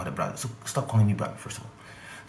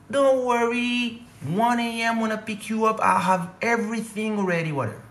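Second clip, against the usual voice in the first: mock promoter; 13 semitones higher than usual. Promoter soothing the comedian on the phone, high mock reassurance. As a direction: ham up a slick promoter's promise.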